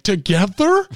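blurted disbelief; shocked. Retold or imitated. Hearing meth and morphine, loud shocked. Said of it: "I was like".

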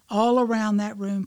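usual speaking voice

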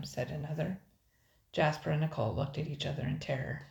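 usual speaking voice

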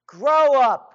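high, loud scolding command; scolding shout. Real, not acted. Ranting host scolds everybody to grow up, loud and high. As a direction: bark it at them like a fed-up parent.

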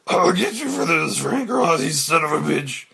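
furious villain scream. Acted. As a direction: roar it at the skyscraper. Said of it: "Elliot, screaming".

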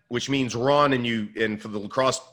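ordinary delivery

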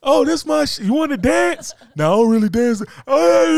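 high, loud club yelling, straining to be heard; yelling over loud music. Retold or imitated. Man acting out a loud club chat, yelling. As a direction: shout over the club music like a young guy.

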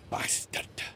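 hissed curse through gritted teeth. Acted. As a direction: spit it through clenched teeth. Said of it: "cursed through gritted teeth".

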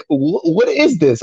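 slow, high-pitched mock bafflement; baffled disbelief. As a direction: stretch it out high, as if stunned. Acts out own baffled reaction.

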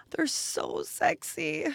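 slow, hushed gushing whisper; awed whisper. Real, not acted. Vlogger seeing her new heels first time, awed whisper. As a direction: whisper it slowly in awe. Admiring the just opened heels.

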